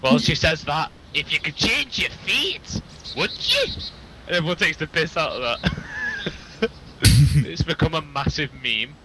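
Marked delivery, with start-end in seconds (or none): 1.1-3.7 slow, lightly imitated Scottish accent of the Brave heroine; a light imitation of a Scottish accent, drawn out. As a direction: quote the ginger girl from Brave, slow, with the last you rising high. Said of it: "ginger girl Scottish accent".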